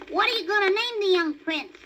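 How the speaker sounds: high little rabbit asking; baby rabbit voice. A narrator's reading. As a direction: voice a small eager bunny. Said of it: "asked the baby rabbit".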